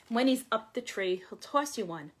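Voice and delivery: ordinary delivery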